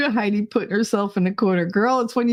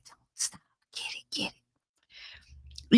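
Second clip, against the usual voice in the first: whispering; 12 dB quieter, slower than usual. Pretend. Acting out egging him on.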